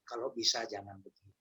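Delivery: hushed, careful mock-gentle rebuke; gentle reproach. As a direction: correct someone without hurting them. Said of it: "gitu ngomongnya".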